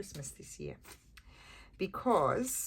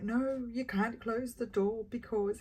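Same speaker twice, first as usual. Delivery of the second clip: high, drawn-out protest in imitation; mock freak-out; 6 semitones higher, slower than usual. Husband objecting to the closed bedroom door, mock panic. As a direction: imitate a panicked no, pitched up and stretched out. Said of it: "he's totally freaking out".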